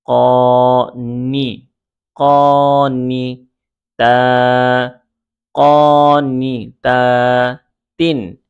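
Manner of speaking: slow, level syllables with gaps; sustained teaching chant; broken into syllables. Shown as a demonstration. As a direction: build the word up piece by piece. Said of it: "উচ্চারণ করে ভেঙে ভেঙে বলতে পারেন".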